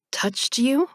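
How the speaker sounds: high, puzzled rising question; quizzical. A narrator's reading. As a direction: ask it in disbelief.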